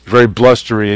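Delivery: ordinary talk, nothing marked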